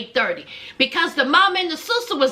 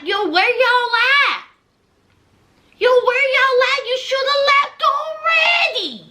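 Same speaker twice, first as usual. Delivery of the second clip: high, raised voice, imitating an impatient man; annoyed calling out; 8 semitones higher, 3 dB louder than usual. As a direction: retell it as a loud, high demand. Acted annoyed call from [name].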